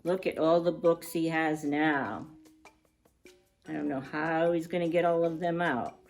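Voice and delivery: ordinary delivery, nothing marked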